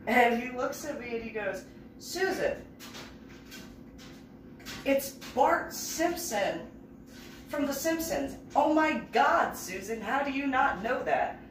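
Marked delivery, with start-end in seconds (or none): 2.0-11.4 higher, slow, stretched disbelief; exasperated kid. Retold or imitated. Young Ian explaining Bart Simpson, higher slow disbelief. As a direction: drag it out like you can't believe her.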